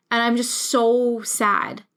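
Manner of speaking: ordinary talk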